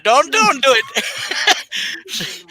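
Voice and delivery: hammed-up high pleading; mock plea. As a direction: stretch it out like a pleading cartoon.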